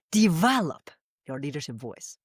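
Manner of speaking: "Develop" stressed up front, the rest falling. Shown as a demonstration. Giving hope to listeners of any age, "Develop" stressed. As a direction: open hard on "Develop". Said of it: "no matter how old you are, you still have hope".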